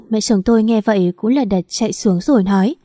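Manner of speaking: plain reading voice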